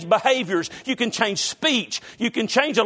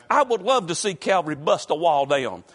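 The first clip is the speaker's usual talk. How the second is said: normal talking voice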